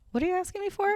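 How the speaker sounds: high, soft puzzled dog; puzzled dog asking what you mean; confused dog voice. Retold or imitated. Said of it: "they kinda look at you like".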